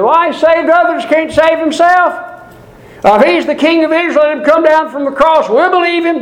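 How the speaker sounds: high, taunting jeer of the crowd; mocking passersby. Preacher voicing those who walked by the cross, high mocking taunt. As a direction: sneer it up high like scoffers at the cross.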